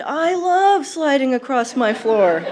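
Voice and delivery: drawn-out, gushing "love" in grandma's voice; gleeful grandmother. Acted. Granddaughter imagining Gram sock skating, gleeful. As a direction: exclaim it slowly with delight. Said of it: "And she probably said".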